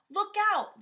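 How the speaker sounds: high, loud shout; warning shout. A narrator's reading. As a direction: yell a warning to Percy. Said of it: "they shouted".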